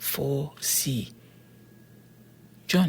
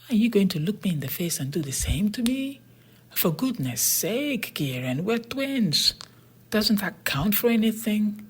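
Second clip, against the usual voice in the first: pleading twin brother; 7 semitones higher than usual. A narrator's reading. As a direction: beg him to remember you're twins. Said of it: "he cried".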